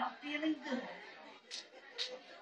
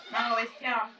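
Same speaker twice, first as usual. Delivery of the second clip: ordinary talk, nothing marked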